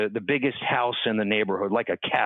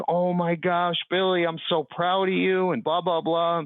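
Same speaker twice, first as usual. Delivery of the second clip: a hint of a gushing, higher mother; light proud-mother voice; proud mom voice; 6 semitones higher than usual. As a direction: lightly voice mom beaming with pride. Mom's proud reaction voiced.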